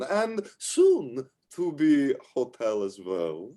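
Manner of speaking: slow, grand welcome in a put-on character voice; effusive tavern hostess. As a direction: play a proud innkeeper drawing out the welcome. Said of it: "she walked in and says".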